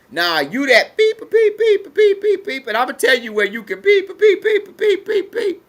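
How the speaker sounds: high, loud bleeped-out tirade; mock cursing out. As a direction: cuss them out, beeps at top pitch. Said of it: "you decide, "You know what?".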